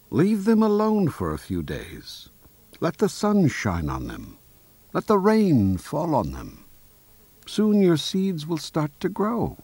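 high-voiced storybook Frog, advising. A narrator's reading. Frog advising Toad about his seeds, high voice. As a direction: pitch it high for a reassuring Frog.

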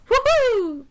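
high, drawn-out mock woohoo; playful cheer. Host hyping the extra-song party, playful cheer. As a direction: toss out a long festive whoop.

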